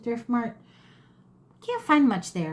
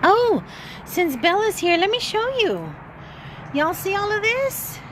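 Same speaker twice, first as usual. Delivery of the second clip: high, louder eager show-and-tell; excited; 10 semitones higher, 3 dB louder than usual. Real, not acted. Excited showing things to camera.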